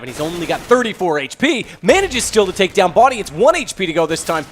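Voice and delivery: loud, amazed esports commentary; emphatic live match call, pitch lifted; excited play-by-play. Real, not acted. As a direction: call a narrow survival with rising disbelief. Live play-by-play, narrow survival.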